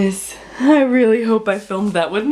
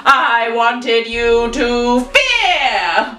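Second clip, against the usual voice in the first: put-on old villain relishing Aiden's fear; villain gloat; 3 semitones higher, much slower than usual. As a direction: savour it, then crow. Villain gloating, slow then shouted.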